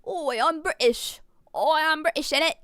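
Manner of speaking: hammed-up British catchphrase; exaggerated British accent. Shown as a demonstration. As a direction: try out a broad British accent. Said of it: "How do I do British?".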